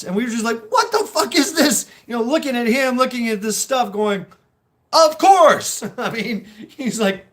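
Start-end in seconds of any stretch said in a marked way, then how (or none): 0.7-1.9 high, loud, laughing disbelief; incredulous laughing outburst. Retold or imitated. Marines gawking at his tiny kit, laughing disbelief. 4.9-5.8 high, much louder burst of mock delight; mock exclamation. Teasing the guy about his kit, loud mock cheer.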